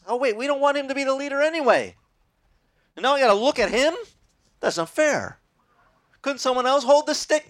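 high, fast whiny complaint; whining Israelites. Retold or imitated. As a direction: whine like a sulky crowd.

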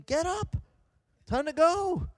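imitated nurse's high, loud call; high, bossy mock command; brisk order. Next-shift nurse rousing patient.

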